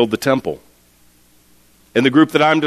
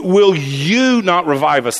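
"you" punched out above the other words; stress on YOU; 3 semitones higher than usual. Shown as a demonstration. Preacher shows where the verse's emphasis lies, stressing "you". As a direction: hit "you" hard, then let "not revive us" fall. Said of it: "That's the emphasis".

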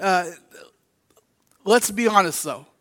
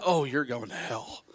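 much lower, quieter cold condemnation; cold judgmental turn; 8 semitones lower, 11 dB quieter than usual. Retold or imitated.

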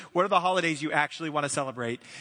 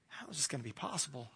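much softer, lower inward question; hushed, acted private doubt; quiet wondering; 6 semitones lower, 15 dB quieter, faster than usual. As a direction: murmur it as a man questioning himself. Voices Abram's questioning heart.

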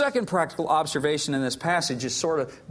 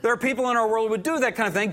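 normal talking voice

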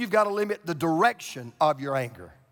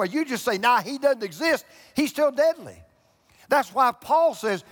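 preaching at full force; 6 semitones higher than usual. Acted. Pastor rousing his congregation about the devil, full-force preaching.

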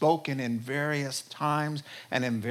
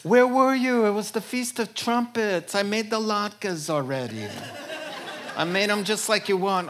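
put-on Yiddish accent; 6 semitones higher, 4 dB louder than usual.

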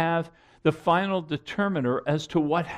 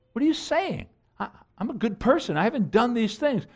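indignant, raised pitch, quicker; offended protest; 7 semitones higher than usual. Retold or imitated. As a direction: play the offended churchgoer objecting. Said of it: "many are offended".

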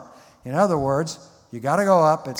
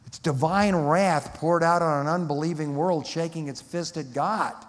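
ordinary talk, nothing marked

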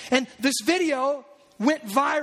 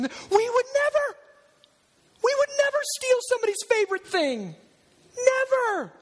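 high, whiny mock protest, far above the usual voice; indignant denial; 10 semitones higher than usual. Brothers protest to Joseph, squeaky and high. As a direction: squeal it like guilty brothers insisting innocence. Brothers deny stealing the cup.

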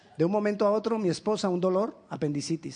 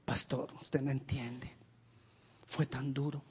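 much softer and slow, sorrowful; mournful plea; 4 semitones lower, 9 dB quieter than usual. Retold or imitated. A grieving member tells the pastor, soft and sorrowful. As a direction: let the hurt drag the words down.

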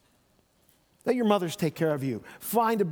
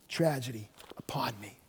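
whisper; 6 semitones lower, 8 dB quieter than usual. Acted. Naomi laments her bitter fate.

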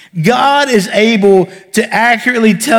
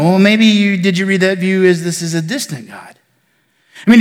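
ordinary delivery

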